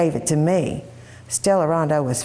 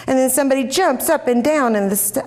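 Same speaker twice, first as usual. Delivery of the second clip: ordinary delivery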